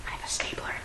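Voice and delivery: unvoiced secret whisper. Real, not acted. Vlogger whispering about her stapler. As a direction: tell the camera a secret without voice.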